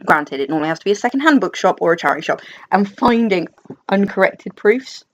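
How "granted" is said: ordinary delivery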